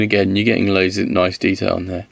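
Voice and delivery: ordinary talk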